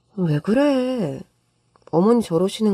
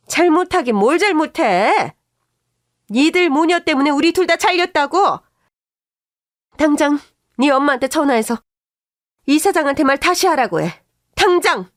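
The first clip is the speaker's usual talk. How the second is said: furious yelling; 7 semitones higher than usual. Acted. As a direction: scream orders at her daughter-in-law. Furious mother-in-law demands on phone.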